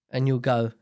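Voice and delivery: usual speaking voice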